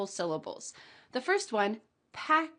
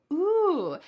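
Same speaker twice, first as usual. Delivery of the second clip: ordinary talk, nothing marked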